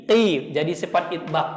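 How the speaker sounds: ordinary talk, nothing marked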